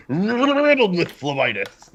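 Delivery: high, slow hammy drawl; silly high voice. Pretend. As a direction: ham up the silly phrase. Joke repeating misheard word.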